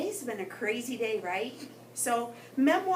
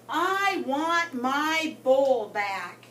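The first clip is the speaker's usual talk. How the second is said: slow, loud, drawn-out yell; screamed; 3 semitones higher, 5 dB louder, much slower than usual. Acted. Goldfish loses it at his tankmates, slow loud yell. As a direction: belt it at the whole crowded bowl. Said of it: "I screamed".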